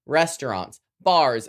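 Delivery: ordinary talk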